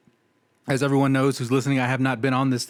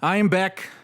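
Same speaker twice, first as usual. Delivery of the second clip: ordinary talk, nothing marked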